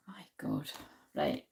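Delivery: breathy whispered oh my God; whispered aside. Real, not acted. Muttering to herself over the cards, whispered.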